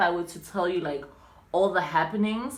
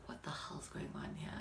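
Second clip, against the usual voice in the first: soft unvoiced whisper, stunned; sitting in the lounge, privately whispering disbelief; 5 semitones lower, 20 dB quieter than usual. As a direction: hush it like a thought you can't say aloud. Silent thought during the questioning.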